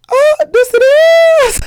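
very high, loud, crying-out squeal; shrieking impression. Retelling the funny live video, she squeals the rapper's line. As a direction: shriek it like the viral clip through laughter. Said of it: "J T in Miami was like".

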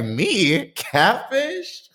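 high, rising disbelief; mock outrage, voice shooting up; incredulous. Indignant retold reply.

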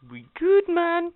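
high-pitched, hammed-up Jamaican accent; bad Jamaican accent. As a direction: toss it off in a jokey fake Jamaican accent. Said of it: "that's a bad imitation of a Jamaican accent".

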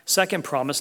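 usual speaking voice

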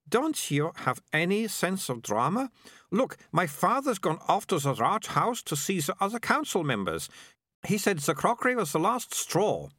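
put-on high, dramatic girl's voice; exasperated Melicia. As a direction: scold them for lacking drama, pitched high. Narrator plays exasperated Melicia.

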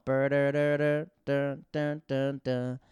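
loud hummed tune backing; imitated party music. Coworkers celebrating the firing, loud imitated music. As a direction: vocalize the horn riff with gusto. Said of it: "people started singing".

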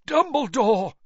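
high, loud, strained gasp; urgent strangled cry. A narrator's reading. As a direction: gasp the name out desperately. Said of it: "gasped Mister Crouch".

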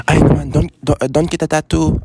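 grandma scolding the grandchild, imitated; imitating grandma. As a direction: do Lola forbidding the tattoo. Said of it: "She's like, she's like".

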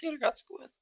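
high, soft squeaky voice; squeaky guest voice. Pretend. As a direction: squeak it out like someone else. Voicing silent guest Leon's answer.

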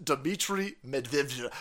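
usual speaking voice

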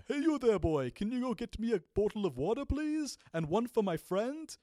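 Scrooge voice. Retold or imitated. Asking him to fetch water, still in the Scrooge voice. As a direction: keep the Scrooge voice for the real request. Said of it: "but he actually said".

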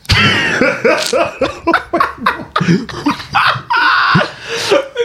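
high shrieking laugh; screaming laugh. Real, not acted. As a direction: howl with laughter. Big laugh after joke.

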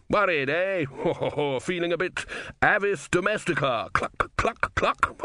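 laughing taunt ending in whispered clucks; gloating chicken impression; mocking chicken clucks. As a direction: call him a coward. Bettor mocks rival as chicken.

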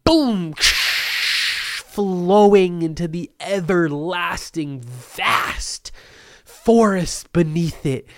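hammed-up epic crescendo; mock-epic build. Mock-epic build to show's name.

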